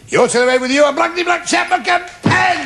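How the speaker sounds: high-pitched, building showman's shout; rousing prize announcement. Acted. Host to losing contestant, rousing prize announcement. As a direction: belt out the prize like a big showman.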